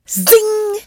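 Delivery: very high, ringing "zing"; high zinging whizz. A narrator's reading. Pebble shot into the blooms, piercing high zing. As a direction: send it flying past like a pebble. Imitated flying stone.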